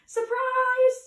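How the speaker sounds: high, loud party shout; shouted surprise. A narrator's reading. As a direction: call it out high and long. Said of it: "everyone popped up out of their hiding places and shouted".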